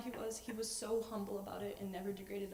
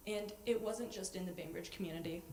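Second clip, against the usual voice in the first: ordinary talk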